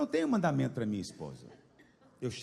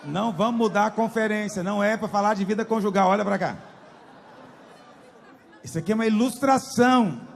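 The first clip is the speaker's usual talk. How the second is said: loud, half-laughing rebuke; shouting at the crowd; 4 semitones higher, 8 dB louder than usual. Real, not acted. Preacher shouting at the congregation to pay attention, half laughing. Preacher scolds distracted audience.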